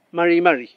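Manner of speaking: "Mari, mari" with a tapped r; clearly sounded "mari" with a light tapped r; correct mari mari. Shown as a demonstration. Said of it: "Esa sería la forma de pronunciar".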